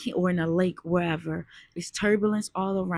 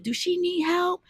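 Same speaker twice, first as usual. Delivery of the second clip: boaters asking; 11 semitones higher than usual. Retold or imitated. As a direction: voice the concerned strangers on the boat. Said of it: "So they're like".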